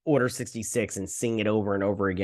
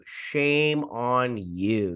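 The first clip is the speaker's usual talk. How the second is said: very slow, emphatic rebuke; drawn-out scolding; much slower than usual. Real, not acted. Reviewer shaming the creature's designer, very slow emphatic scolding. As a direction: stretch out each word to shame them.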